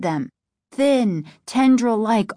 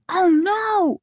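high, wailing exclamation; high-pitched stricken gasp of words; horrified dismay; 10 semitones higher than usual. A narrator's reading. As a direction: cry out in dismay. Boy sees father tied up.

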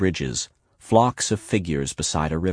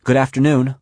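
high, louder chirped greeting; chirpy; 7 semitones higher, 5 dB louder than usual. A narrator's reading. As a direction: chirp it politely, pitched well up. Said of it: "Werner will chirp".

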